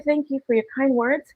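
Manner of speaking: usual speaking voice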